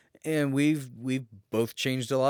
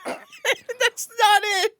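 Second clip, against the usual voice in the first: laughing; 7 dB louder, much slower than usual. Real, not acted. Cracks up at own joke.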